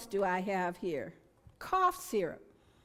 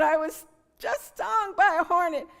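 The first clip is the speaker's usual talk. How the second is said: excited retelling; 7 semitones higher, 7 dB louder than usual. Retold or imitated. Retold reply after sting.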